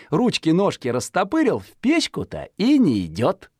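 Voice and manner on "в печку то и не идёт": plain reading voice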